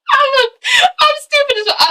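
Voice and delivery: high squeaky words through real laughter; laughing. Real, not acted. Vlogger amused by own silly joke, laughing. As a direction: barely get it out while cracking up.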